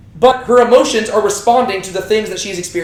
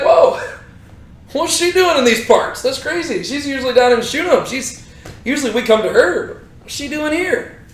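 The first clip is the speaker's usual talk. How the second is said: higher-pitched mock astonishment; playful surprise; 5 semitones higher than usual. Prophet spots her far from Shunem, surprised. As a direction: act out a puzzled, amused double take.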